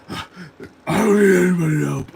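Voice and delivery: loud, overblown growling roar; Batman. Retold or imitated. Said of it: "That voice takes me out".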